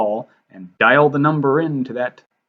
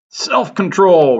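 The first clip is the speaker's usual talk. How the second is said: loud, high, drawn-out disbelief; incredulous; 6 semitones higher, 6 dB louder, much slower than usual. A narrator's reading. Tom throwing her word back at her, incredulous. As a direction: repeat it slowly in scornful amazement. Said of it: "repeated Tom incredulously".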